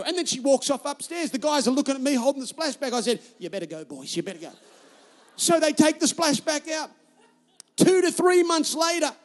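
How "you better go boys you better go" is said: low, soft warning to the workmen; retold hushed dismissal, pitched well down; resigned aside. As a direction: mutter it low, giving up. Retold resigned word to the builders.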